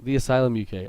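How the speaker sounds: "Asylum" said uh-SIGH-lum before U K; "Asylum" stressed on its long i; name read as Asylum. Shown as a demonstration. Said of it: "I'm so sorry".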